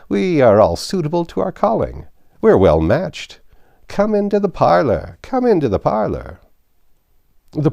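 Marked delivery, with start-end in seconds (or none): 0.1-6.4 put-on high, loud, creaky old man voice; old Joe, cackling old rascal. Old Joe greeting the thieves, high and gleeful. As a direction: wheeze it out like a gleeful old fence.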